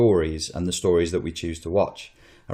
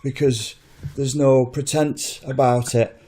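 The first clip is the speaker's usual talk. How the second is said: higher, put-on Northern English accent, slow at the end; Jon Snow accent; 7 semitones higher than usual. Doing Jon Snow's Northern English accent to a podcast guest. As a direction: deliver it as a laconic Northern English everyman. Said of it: "That was quite good".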